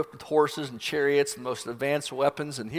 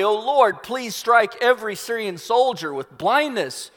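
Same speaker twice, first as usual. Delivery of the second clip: higher, urgent plea to God; fervent prayer; 6 semitones higher than usual. Acted. Prophet prays against the marching army, fervent. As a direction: pray aloud with raised pitch. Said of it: "he prays to Yahweh".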